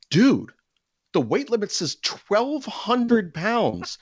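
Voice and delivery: high, slow indignant protest. Retold or imitated. Arguing with the lifeguard about the weight limit, high slow indignation. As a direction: spell it out in outrage.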